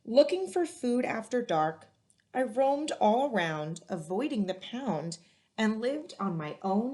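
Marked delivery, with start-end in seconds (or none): none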